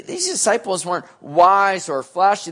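ordinary talk, nothing marked

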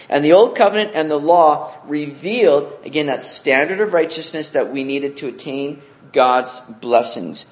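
usual speaking voice

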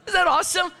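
thrilled, sell the wonder of the word; excited exclaim. Real, not acted. Pastor thrills to the congregation about hilarious giving, excited.

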